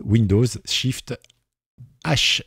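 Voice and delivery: slow, deliberate key-by-key readout; naming each key as he presses it; slow dictation. Shown as a demonstration. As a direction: spell out the keys slowly, one by one. Spells out the key shortcut.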